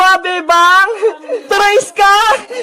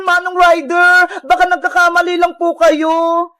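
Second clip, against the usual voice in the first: usual speaking voice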